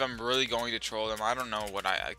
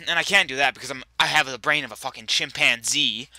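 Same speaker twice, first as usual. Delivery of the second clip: louder, a burst of annoyance at his own clumsiness; loud frustrated rant; 4 semitones higher, 8 dB louder than usual. Real, not acted. Gamer venting to viewers about failing, loud exasperated rant. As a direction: raise your voice and vent, fast and exasperated.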